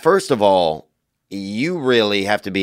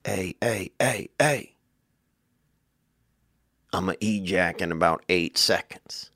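body's warning voice; 2 semitones lower, 6 dB quieter than usual. Pretend. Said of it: "your body says".

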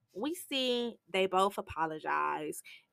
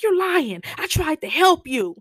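loud, high accusing cry; angry accusation; 9 semitones higher, 6 dB louder than usual. Retold or imitated. Willie accusing the man who denies it, loud and high. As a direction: yell it at the liar.

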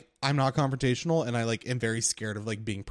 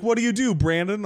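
eager mechanic; 8 semitones higher, 7 dB louder than usual. Retold or imitated. Tony asks about his job.

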